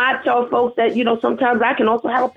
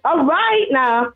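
high, drawn-out "all right now"; mock warning; 7 semitones higher, slower than usual. Guest retelling her answer about her accent, mock warning. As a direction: push back playfully, stretching it out. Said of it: "So I'm like".

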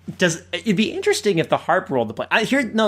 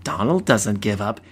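imitated narrator; 7 semitones lower than usual. Reading out the cartoon's narration to a co-host, low narrator voice. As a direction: voice a solemn storybook narration. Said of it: "he actually reacts to the narration".

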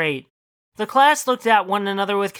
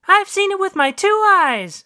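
pitched-up loud talking dog; high dog voice; 11 semitones higher, 5 dB louder than usual. Acted. Dog tells wife what it saw, high and loud. Dog testifies against Lester.

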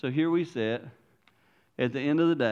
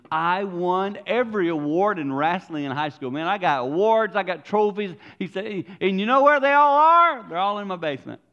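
put-on young man quoted; 7 semitones higher, 7 dB louder than usual. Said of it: "A young man this week said to me".

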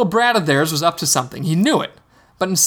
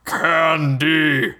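dim slow booming giant. Acted. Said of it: "the giant boomed".

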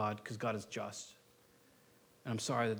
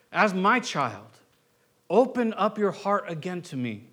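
high, loud, impassioned preaching; preaching at full force; 8 semitones higher, 11 dB louder than usual. Acted. Pastor pleads with the congregation to open their hearts, loud and impassioned. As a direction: plead with them from the pulpit at full voice.

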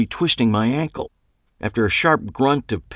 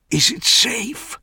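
high, slow, nervous dandy; fussy Clive voice; slower than usual. A narrator's reading. Clive hiding behind the car asks nervously, high slow voice. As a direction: peer out and ask fearfully, higher.

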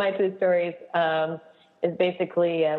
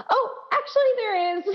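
put-on little girl voice. Little girl's confession, voiced.

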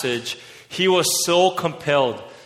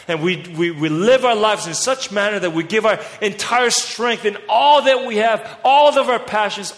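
preaching louder and higher, building passion; fiery preaching; 4 semitones higher, 3 dB louder than usual. Acted. Preacher urging his church to give everything, at full force. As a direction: whip the congregation up into the race.

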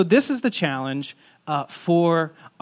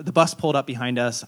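ordinary talk

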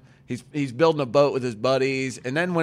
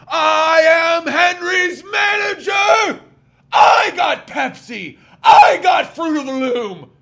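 high, loud, slow hammed-up shout; mock boasting stepdad; 14 semitones higher, 10 dB louder, slower than usual. Stepdad bragging about being the manager, loud mock shout. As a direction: proclaim it like a blowhard.

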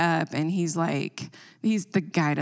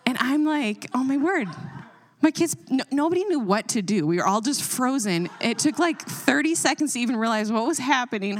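excited; 7 semitones higher than usual. Real, not acted. Excited retelling, raised.

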